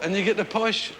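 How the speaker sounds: ordinary delivery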